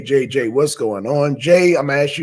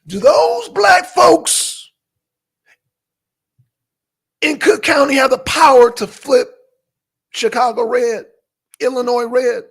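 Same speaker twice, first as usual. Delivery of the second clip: loud challenge; 9 semitones higher, 5 dB louder than usual. Real, not acted. Pressing Deacon with a pointed question.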